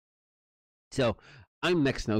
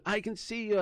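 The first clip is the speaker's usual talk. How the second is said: higher sing-song mock officer; teasing officer; 12 semitones higher than usual. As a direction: play the cop calling through the letterbox. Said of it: "he's going".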